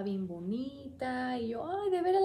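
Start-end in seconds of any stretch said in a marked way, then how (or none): 1.7-2.2 pleased surprise. Retold or imitated. Told her baby is pretty.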